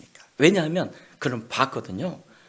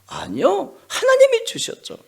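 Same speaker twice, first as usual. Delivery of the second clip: much higher, louder whining reply; whiny Jonah; 8 dB louder than usual. Acted. Jonah whining his answer to God. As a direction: whine it back defensively. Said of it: "Jonah answers God".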